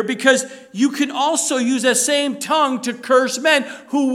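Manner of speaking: loud, rising sermon delivery, pressing the congregation; preaching at full force. Acted. Preacher warning his congregation, loud full-force preaching. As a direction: preach it hard, pleading and warning.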